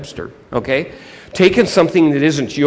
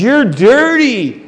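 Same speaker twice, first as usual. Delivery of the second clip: exaggerated, raised-voice disapproval; disgusted church leader; 9 semitones higher, 11 dB louder than usual. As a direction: scold the man for being filthy. Church leader recoiling from victim.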